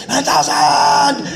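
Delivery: very high, slow shout of "nine thousand"; odd drawn-out yelled dub read; odd stretched shout. Retold or imitated. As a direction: bellow it long to fill every flap. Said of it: "a very odd sounding read".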